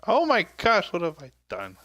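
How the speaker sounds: high, loud start dropping back; dismayed outburst. Real, not acted. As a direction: blurt it out on spotting your mistake. Mock dismay at own mistake.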